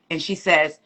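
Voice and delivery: usual speaking voice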